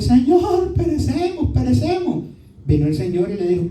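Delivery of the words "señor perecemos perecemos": high, desperate plea of drowning men; frightened cry. Acted. Disciples in the storm crying to Jesus, high and terrified. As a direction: cry out in terror to be saved. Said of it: "los discípulos vinieron y le dijeron".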